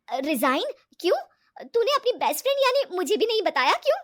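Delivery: high, loud astonished complaint; shocked reproach. Acted. Ritu learning her best friend is resigning, shocked reproach. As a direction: demand why you weren't told.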